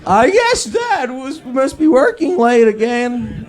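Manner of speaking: put-on southern accent. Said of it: "Very southern".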